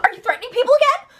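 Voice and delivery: squeaky high, fast mock of a whiny guy. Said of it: "pulls out his freaking high voice".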